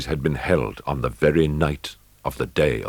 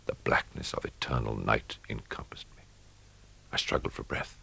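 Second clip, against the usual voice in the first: softer, almost no voice; whispered; 4 semitones lower, 8 dB quieter than usual. A narrator's reading. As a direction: hush it as if the dark stifles you. Narrator engulfed in total darkness.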